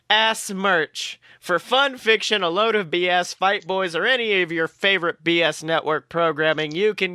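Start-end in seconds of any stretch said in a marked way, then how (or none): none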